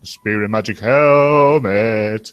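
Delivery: very slow, stretched-out delivery; half-speed, grandly stretched catchphrase; drawn-out quote. Acted. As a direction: draw out every word, stretching HELMET. Repeats the line for show.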